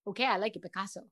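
softly tossed-off catchphrase; quiet throwaway TikTok line; TikTok catchphrase, muttered. Shown as a demonstration. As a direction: mumble it low to yourself. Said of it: "I'm a TikTok talker".

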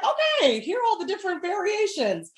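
high, light comic quote; playful mock acceptance; acted realization. Acts out her reaction to accents.

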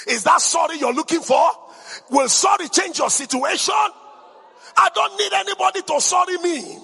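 loud rousing preacher declaring over music; full-force preaching. Acted. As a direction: proclaim it like thunder to the congregation.

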